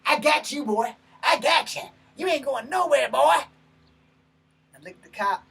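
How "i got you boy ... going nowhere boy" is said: loud, high bellowing cop voice; big cop pinning him down. Retold or imitated. Cop pinning him to the seat, loud bellow. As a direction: roar it like a cop holding you down. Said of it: "let's say it how it actually was".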